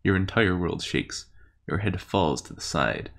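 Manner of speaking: game master narration. Acted.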